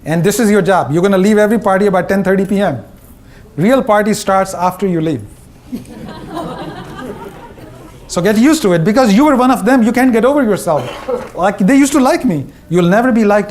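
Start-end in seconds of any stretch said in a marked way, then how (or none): 5.7-7.3 amused laugh. Real, not acted. Speaker laughs at own joke.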